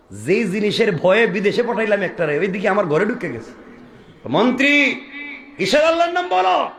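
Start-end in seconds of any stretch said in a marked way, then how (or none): 4.3-6.7 high, shouted rebuke in character; king's angry challenge. Acted. King confronting minister over Allah's name, high angry shout. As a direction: demand it sharply, climbing high.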